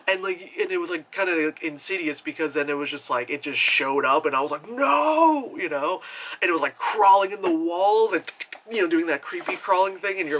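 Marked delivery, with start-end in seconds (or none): 4.7-5.5 high, loud drawn-out cry; horrified outburst. Retold or imitated. Viewer seeing the masked child appear, horrified cry. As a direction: wail it in mock horror. Reacts to horror apparition.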